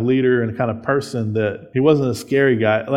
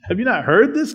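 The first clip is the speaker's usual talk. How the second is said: higher, mock-incredulous Jesus; Jesus challenging Pharisees; 8 semitones higher than usual. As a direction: challenge them as Jesus, half teasing. Preacher voices Jesus to Pharisees.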